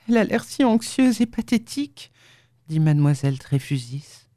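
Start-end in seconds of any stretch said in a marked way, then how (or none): 0.1-2.1 put-on concerned young woman. A narrator's reading. Leonora about the mute patient, pitying concern.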